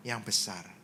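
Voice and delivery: low, soft reply; meek answer. Retold or imitated. Speaker answers God about the children, meek low reply. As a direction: answer quietly, dropping right down.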